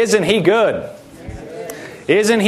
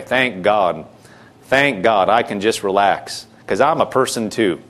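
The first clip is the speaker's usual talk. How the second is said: much lower, unhurried sigh of relief, voice let right down; relieved and relaxed; 9 semitones lower than usual. Acted. A believer handing God his burden, lower and relieved. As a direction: let the weight drop off and breathe easy.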